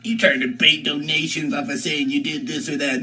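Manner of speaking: a light sneering troll voice, barely changed; mocking troll. Streamer mimics the trolls who accuse him, in a light mocking voice. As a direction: poke fun at the accusation in a lightly put-on voice. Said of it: "the trolls would be like".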